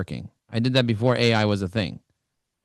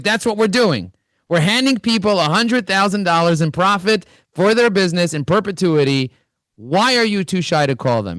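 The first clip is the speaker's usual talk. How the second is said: higher and louder pep talk to a shy rep; fired-up pep talk; 7 semitones higher, 4 dB louder than usual. Retold or imitated. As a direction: pump up the rep, pitched high. Coach pushing shy sales rep.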